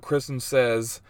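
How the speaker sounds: normal talking voice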